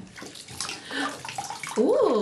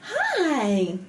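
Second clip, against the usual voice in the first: usual speaking voice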